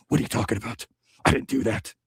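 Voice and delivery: low, hushed action-hero impression; Stallone movie whisper. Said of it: "like, whispers, or Nick Cage whispers".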